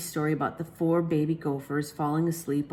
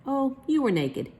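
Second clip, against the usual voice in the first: mother voice; 9 semitones higher than usual. Acted. Mother's amused answer.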